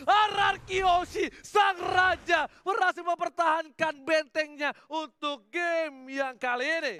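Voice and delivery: slow, loud, drawn-out victory call; triumphant shout. Real, not acted. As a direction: belt out the win. Caster calling the game win.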